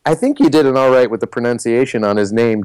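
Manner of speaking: ordinary delivery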